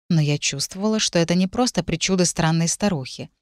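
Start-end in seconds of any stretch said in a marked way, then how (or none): none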